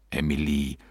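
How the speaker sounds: low, soft address to his wife; subdued husband. A narrator's reading. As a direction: drop low and quiet, addressing your wife.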